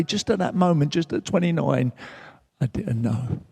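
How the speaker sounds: ordinary talk, nothing marked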